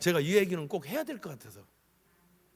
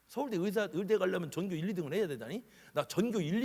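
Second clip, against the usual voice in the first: higher, rising to an indignant whine; mock a proud doctor complaining; hammed-up offended doctor; imitating a boastful doctor; 5 semitones higher than usual. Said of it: "이렇게 얘기하더냐 이거요".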